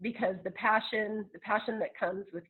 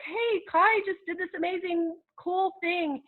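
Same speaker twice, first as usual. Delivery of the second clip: excited; 11 semitones higher, 3 dB louder than usual. Retold or imitated. Quoting her own proud post.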